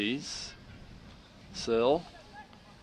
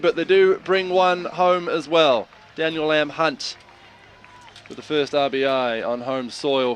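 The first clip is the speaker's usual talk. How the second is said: loud, slow, rising play-by-play call; excited call; 4 semitones higher, 11 dB louder than usual. Real, not acted. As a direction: lift it for the run scoring.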